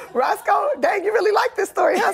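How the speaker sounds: high laughing outburst; real laughing shout at the crew. Real, not acted. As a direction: call it out through laughter.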